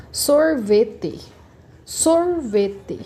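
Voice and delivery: sorvete slowly, as SOR, VE, TE; syllable by syllable. Shown as a demonstration. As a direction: sound out sorvete in separate drawn-out beats. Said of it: "Minha sílaba mais forte".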